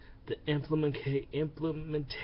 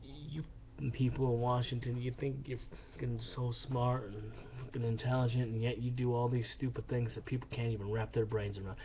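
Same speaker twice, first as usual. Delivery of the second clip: ordinary delivery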